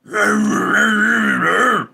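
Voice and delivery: high, loud throaty voice; put-on throaty noise. Silly raspy noise before throat cancer question.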